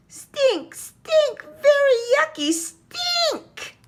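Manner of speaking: very high, loud, drawn-out yell. A narrator's reading. Little brother yells about the skunk hug, very high loud yell. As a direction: shriek it like a disgusted little kid. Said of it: "yelled Tate".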